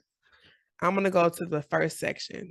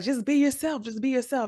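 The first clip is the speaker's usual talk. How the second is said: husband's advice; 7 semitones higher than usual. Retold or imitated. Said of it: "He was like".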